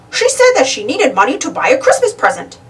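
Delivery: bright recognition from the shopkeeper. A narrator's reading. Shopkeeper answering Donald about Minnie, high character voice.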